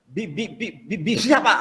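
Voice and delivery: stammering, syllables repeated haltingly; stuttering job seeker. Acted. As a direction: stutter through every word like a nervous applicant. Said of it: "tahu ya orang gagap ya?".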